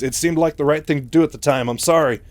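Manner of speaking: a touch of panic in a retold defence; slight panic. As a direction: act out a panicky apology. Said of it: "still panicking slightly".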